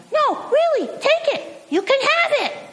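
put-on very high, pleading child's voice; insistent little boy. Boy insists the football star take his soda, very high pleading child voice. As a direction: push the soda on him like a determined kid. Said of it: "the kid is not to be deterred".

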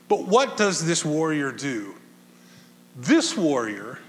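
ordinary talk, nothing marked

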